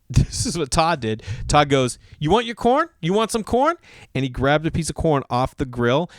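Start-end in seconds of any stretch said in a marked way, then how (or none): 2.2-3.8 high, mocking questions; fed-up friend's pitched-up taunt; taunting offer. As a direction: dangle it in a high, goading voice. Fed-up friend taunts kid with corn.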